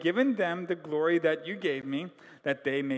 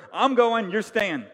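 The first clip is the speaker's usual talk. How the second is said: louder, higher, driving it home; emphatic repeated warning; 5 semitones higher, 9 dB louder than usual. Retold or imitated. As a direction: hammer the point. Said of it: "He's told them over and over".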